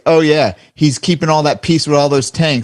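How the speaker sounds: mock Trump's sneering sarcasm, half in jest; sarcastic Trump. Host mimics Trump mocking the tank-heavy peacekeeping, lightly sarcastic. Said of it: "you can hear the sarcasm in Trump's voice".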